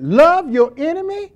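ordinary delivery

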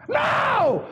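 high, drawn-out preacher's shout; emphatic outcry. Acted. Preacher rejecting the medical explanation, emphatic high outcry. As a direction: cry it out as a flat refusal. Preacher cries out against the deacon.

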